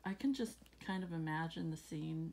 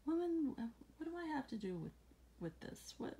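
frustrated; 9 semitones higher than usual. Retold or imitated. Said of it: "it sounds like he's a little frustrated".

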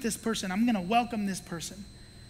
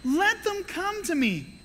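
indignant insistence; 6 semitones higher, 3 dB louder than usual. Retold or imitated. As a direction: insist firmly on the children. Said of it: "he was like".

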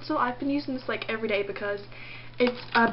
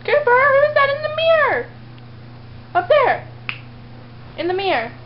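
excited pet talk; 8 semitones higher than usual. Real, not acted. Owner urges Cooper to bark at the mirror, high squeal. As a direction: pitch way up and egg the dog on.

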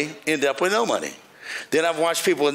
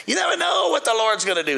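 preaching shout; 8 semitones higher than usual. Acted. Preacher proclaiming to the congregation, rising preacher's cry. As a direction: proclaim it to the back pew.

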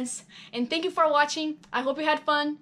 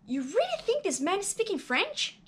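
doubtful director; 8 semitones higher than usual. Acted.

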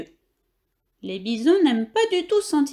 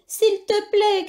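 high pleading cry at the end of his strength; exhausted shouting plea; 8 semitones higher, slower than usual. Acted. Hamster on a galloping bison begs it to stop, crying out. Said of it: "crie Billy à bout de force".

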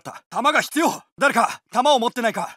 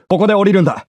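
the same order, louder; louder version of the order; louder take; 6 semitones lower, 7 dB louder, much faster than usual. Acted. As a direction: say it over the gunfire.

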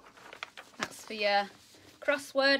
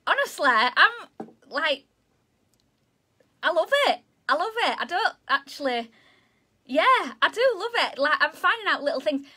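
high, loud gushing delight; bubbling over about the word search; 6 semitones higher, 5 dB louder than usual. Real, not acted. Teacher gushing to pupils about loving the word search.

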